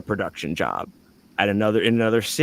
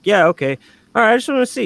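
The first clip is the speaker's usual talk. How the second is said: mimicking the coworker; 11 semitones higher, 4 dB louder, much faster than usual. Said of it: "he was like".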